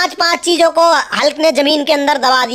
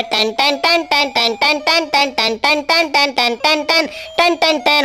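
piano imitation; slower than usual. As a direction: tap out the notes with your voice. Imitating toy piano notes. (धीमे से piano की नकल)

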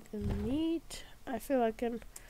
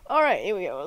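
louder, energetic all right; 4 semitones lower, 11 dB louder, much faster than usual. Real, not acted. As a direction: kick it off with a louder call. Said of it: "me and my sick voice".

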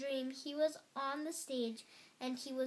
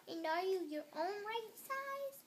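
high, lighter question rising at the end; anxious question; 8 semitones higher than usual. A narrator's reading. Toad checking on Frog after the dream, high questioning. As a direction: ask high and unsure.

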